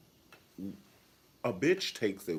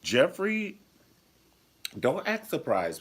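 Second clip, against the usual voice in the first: calling out; 10 semitones higher, 5 dB louder than usual. Real, not acted. Calling out character.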